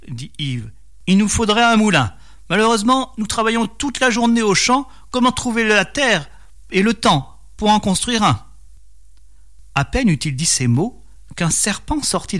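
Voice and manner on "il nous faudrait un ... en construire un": lightly voiced peasant, pitched up; storyteller acting a worried farmer; troubled peasant husband. A narrator's reading. Poor peasant wishing for a mill, lightly acted worried voice.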